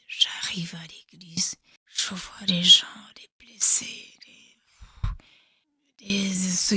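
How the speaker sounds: whispered, breath only; hushed whisper. Real, not acted. Survivor recalling wounded at the church, whispered. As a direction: drop to a whisper at the memory.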